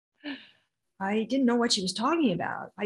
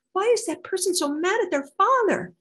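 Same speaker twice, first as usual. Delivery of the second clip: put-on high, loud voice of her childhood self; child asking; 10 semitones higher, 6 dB louder than usual. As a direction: ask like an insistent, puzzled child. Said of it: "I would look across a room and I'd say".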